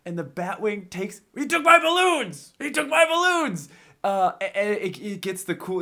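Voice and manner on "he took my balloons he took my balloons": high-pitched hammed-up movie villain whine; mock outrage, wailing over stolen toys; higher, theatrical villain yell; outraged Joker. Acts out the Joker's outburst.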